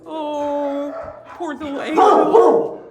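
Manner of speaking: normal talking voice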